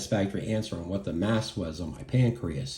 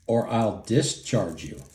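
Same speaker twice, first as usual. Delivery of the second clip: usual speaking voice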